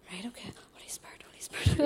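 hurried, hushed whisper, mimicking prayer; imitated whispered prayer. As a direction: whisper it fast like flustered prayer partners. Imitates girls starting hurried prayer.

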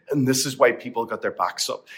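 ordinary delivery

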